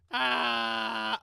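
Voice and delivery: loud high scream; game scream. Pretend. Player starting the round too soon, screamed. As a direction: premature full-volume yell. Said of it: "I screamed too early".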